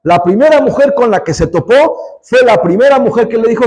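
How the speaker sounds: ordinary talk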